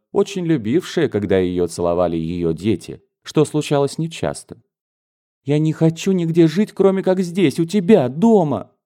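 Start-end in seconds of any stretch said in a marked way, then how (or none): 5.4-8.7 put-on higher, an earnest girl begging to stay home; insist, clinging, you want only home; pleading daughter. A daughter telling her mother she wants to live only here.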